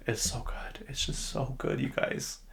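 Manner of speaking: soft, half-whispered rave; hushed gushing. Real, not acted. Confessing love for a favorite song, hushed gushing. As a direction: drop to a near whisper, in awe of it.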